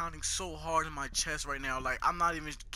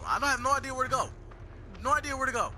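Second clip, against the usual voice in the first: panicked; 8 semitones higher, 4 dB louder, faster than usual. Real, not acted. Running from monster.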